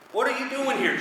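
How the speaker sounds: high-pitched mock protest; startled demons. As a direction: demons acted out in high alarm. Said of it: "And they're like".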